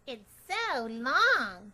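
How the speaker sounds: loud, slow mocking kid; drawn out schoolyard tease; teasing classmate. Classmate teasing her about her long name, loud.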